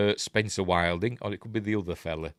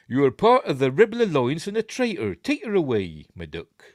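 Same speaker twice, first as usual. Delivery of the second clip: a broad, hammed-up Bristolian accent, louder and higher; 5 semitones higher, 5 dB louder than usual. Imitating the actor for the viewers, in a mock Bristolian accent. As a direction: play Darth Vader as a Bristolian saying my duck. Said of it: "In, in his Bristolian accent".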